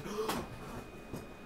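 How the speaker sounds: sharp unvoiced gasp; breathy shocked gasp; high gasp. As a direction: gasp like you can't believe it. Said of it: "I was like".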